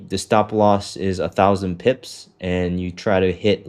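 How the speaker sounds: ordinary talk, nothing marked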